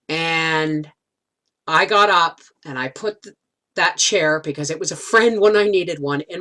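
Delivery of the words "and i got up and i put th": normal talking voice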